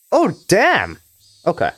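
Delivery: ordinary delivery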